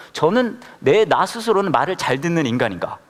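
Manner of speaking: ordinary talk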